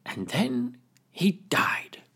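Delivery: slow hushed whisper; dramatic whisper. Acted. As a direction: drop to a whisper for effect. Crayfish ends boast.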